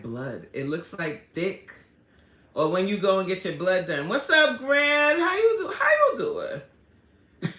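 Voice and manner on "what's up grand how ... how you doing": high, bright-eyed hello to a new viewer; delighted shout-out with a laugh; excited greeting. Real, not acted. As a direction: call out the welcome, stretching the last words through a laugh. Greeting a viewer who joined.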